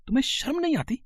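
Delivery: high outraged squeal of a question; outraged rebuke. Acted. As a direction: shriek it in disbelief. Son rebuking drunk women.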